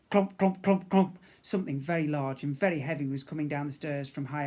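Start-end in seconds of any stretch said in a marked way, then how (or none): 0.1-1.2 clomping footsteps. Acted. Footstep sounds.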